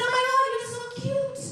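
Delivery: high, singsong gushing impression; gushing English lady. English lady to comedian after being sworn at, gushing. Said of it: "an English lady came up to me".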